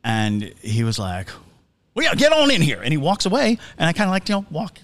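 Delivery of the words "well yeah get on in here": loud, high Southern accent, imitated; Foghorn Leghorn. Eccentric host waving a visitor inside, blustery Southern accent. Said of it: "he sounded like Foghorn Leghorn".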